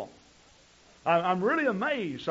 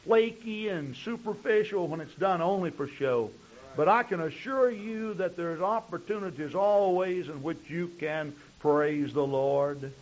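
usual speaking voice

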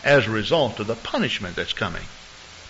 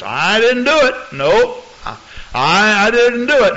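defensive denial; 8 semitones higher, 4 dB louder than usual. Retold or imitated. Accused member denying, acted.

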